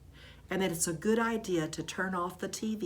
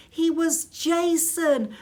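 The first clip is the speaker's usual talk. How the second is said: excited; 11 semitones higher, 11 dB louder, slower than usual. A narrator's reading. Surprise reveal of son.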